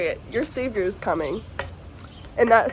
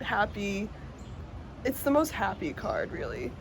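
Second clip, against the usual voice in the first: soft, tearful and much quieter; emotional; 7 dB quieter than usual. Real, not acted. Tarot reader describing the Sun card, softly tearful. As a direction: speak through held-back tears. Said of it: "I'm fucking emotional".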